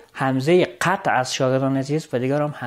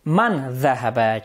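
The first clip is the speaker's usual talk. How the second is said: 1 slow demo question; 3 semitones lower, much slower than usual. Shown as a demonstration.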